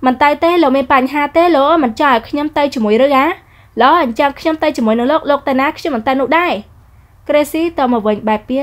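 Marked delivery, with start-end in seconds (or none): 0.1-6.5 higher, sharp retort from a proud heroine; haughty young woman. Acted. Heroine snapping back at the rude man, higher pitched. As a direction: toss it back at him, offended.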